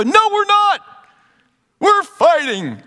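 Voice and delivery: high mock tattling yell; brother calling out. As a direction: holler the denial, dragging out the last words. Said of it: "my brother would go".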